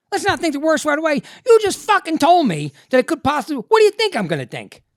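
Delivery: loud, high furious retort; indignant outrage. Retold or imitated. Patient raging back at the doctor's reassurance, loud and high. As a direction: hurl the doctor's words back angrily.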